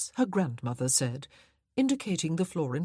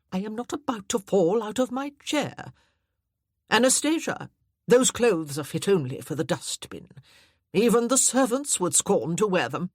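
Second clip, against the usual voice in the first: haughty dowager; 3 semitones higher, 5 dB louder than usual. Acted. Dowager scolds.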